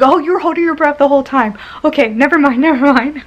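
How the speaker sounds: high, fast and laughing, a mock-surprised nurse; amused nurse catching her own mistake; laughing nurse quote. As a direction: rattle it off high with a laugh breaking through.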